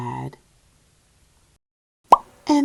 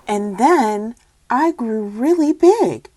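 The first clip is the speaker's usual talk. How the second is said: plain reading voice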